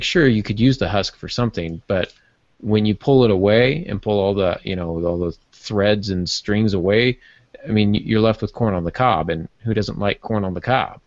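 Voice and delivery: ordinary talk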